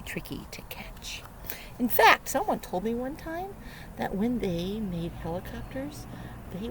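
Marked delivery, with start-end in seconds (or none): 0.0-1.2 secretive whisper. Acted. Sneaky dragonflies whispered.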